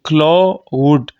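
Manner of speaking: क्लॉउड drawn out in two parts, "klo... ud"; diphthong split. Shown as a demonstration. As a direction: separate the "o" and "u" of क्लॉउड, slowly. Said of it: "एक vowel से मैं दूसरे vowel की तरफ गया". (क्लॉउड को खींचकर "क्लो... उड" बोलें)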